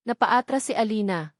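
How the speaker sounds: usual speaking voice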